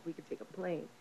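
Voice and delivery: the line she keeps replaying to herself, murmured soft; echo in her mind. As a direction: drop it to a faint inner echo. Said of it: "she keeps repeating that in her mind".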